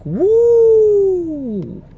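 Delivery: sudden high excited woo; high whoop. Real, not acted. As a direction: let out a long high whoop. Cheer mid-show.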